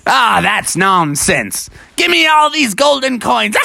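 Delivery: hammed-up greed, rising in pitch; greedy Bubby. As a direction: brush it off, then grab greedily. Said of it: "And Bubby said".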